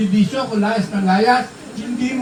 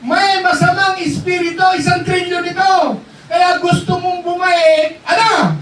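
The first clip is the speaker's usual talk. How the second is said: fiery preaching; 10 semitones higher than usual. Acted. Preacher rousing the youth about darkness, high shouted fervor. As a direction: preach it hard to the back row.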